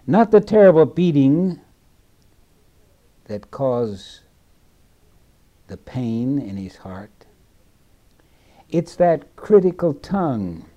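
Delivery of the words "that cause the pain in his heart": hushed whisper on the heart's pain. Real, not acted. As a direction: breathe it out softly. Preacher drops to a whisper.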